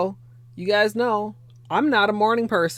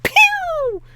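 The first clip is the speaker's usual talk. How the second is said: high, drawn-out pew; pew sound effect; 8 dB louder than usual. Retold or imitated. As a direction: zap noise like a seed shot. Seed shoots out at you.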